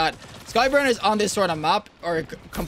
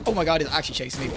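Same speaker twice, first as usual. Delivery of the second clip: usual speaking voice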